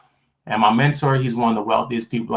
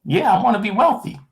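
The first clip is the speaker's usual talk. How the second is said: eager answer; 12 semitones higher, faster than usual. Retold or imitated. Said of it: "I'm like".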